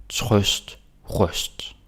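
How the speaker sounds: "trøst" with an open ø like the vowel in "thought"; Danish pronunciation. Shown as a demonstration. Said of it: "this one is trøst".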